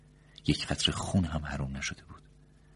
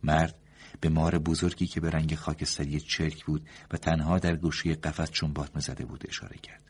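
usual speaking voice

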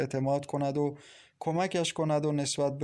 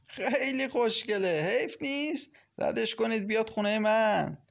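leering, ugly glee; 8 semitones higher than usual. A narrator's reading. Mashallah asking for the pretty girl, leering gleefully. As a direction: pitch it way up, lecherous and gloating. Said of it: "با خندهٔ کری گفت".

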